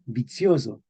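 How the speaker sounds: vicioso as bi-TSIO-zo; medieval pronunciation. Shown as a demonstration. Teacher assembling the old word, medieval pronunciation. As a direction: say vicioso with ts, then a voiced z. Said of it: "esto lo decían ellos".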